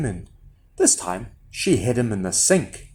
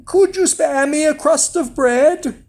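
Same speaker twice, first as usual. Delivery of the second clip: very high whining plea; high put-on beggar's whine; whining disguise; 13 semitones higher, 4 dB louder than usual. A narrator's reading. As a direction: whine it like a begging boy. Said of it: "whined Jack in a completely different accent".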